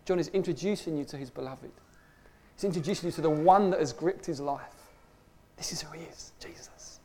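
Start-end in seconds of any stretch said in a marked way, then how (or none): 5.6-7.0 hushed reveal, breath only; a soft confiding whisper of his name. Acted. Dramatic reveal of the beloved.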